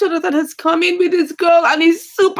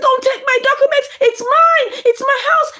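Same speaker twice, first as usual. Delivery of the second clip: put-on higher, pleading child voice; a young girl protesting; pleading daughter; 7 semitones higher than usual. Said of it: "And I said".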